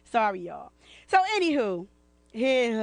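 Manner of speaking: normal talking voice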